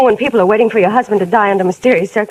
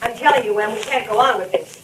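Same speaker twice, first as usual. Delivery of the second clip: hushed; 6 dB quieter than usual. Acted. Secret talk overheard through wall.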